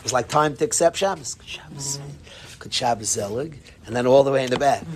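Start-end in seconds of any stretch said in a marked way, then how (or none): 1.3-3.6 gentle. Retold or imitated. Rebbe suddenly calm, blessing the students, gentle and soft. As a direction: say it gently, calmed down. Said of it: "in the most gentle of ways".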